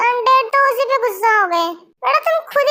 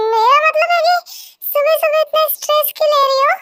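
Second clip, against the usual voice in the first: nervous backpedal; 4 semitones higher than usual. Acted. Son backpedals after angering mother.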